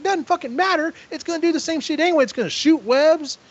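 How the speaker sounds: high, fast retold rant; berating. Tyler dismisses the trapdoor spider idea, high berating rant. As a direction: cut him off with high-pitched exasperation. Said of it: "he is berating him constantly".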